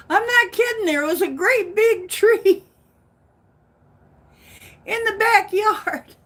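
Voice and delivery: high, loud disbelieving rush; excited disbelief. Real, not acted. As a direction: insist it's true, amazed. Incredulous about vanished tree.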